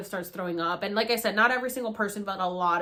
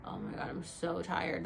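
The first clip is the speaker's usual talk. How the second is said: low, soft, exhausted; weary groan; 3 semitones lower, 8 dB quieter than usual. Retold or imitated. To herself all night, soft exhausted groan. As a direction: sag into it, drained. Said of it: "That was me the entire night".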